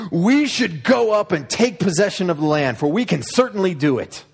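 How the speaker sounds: rally the crowd like a brave leader; rallying cry. Acted. Caleb rallying the people.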